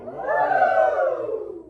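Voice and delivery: high, loud falsetto whoop; falsetto woo. Shown as a demonstration. Choir teacher modelling falsetto again. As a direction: throw it way up. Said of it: "Throw it way up there".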